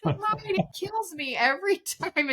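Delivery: telling a friend why the line kills her, laughing; laughing quote. Retold or imitated. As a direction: quote yourself while cracking up. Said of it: "I'm like".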